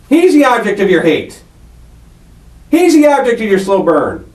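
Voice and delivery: ordinary delivery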